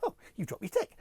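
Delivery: high, excited dog character; eager dog. Retold or imitated. Vlogger imitating a comedy sketch's dog fetching sticks, high and eager. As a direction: chirp it like a helpful dog. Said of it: "The dog throwing sticks".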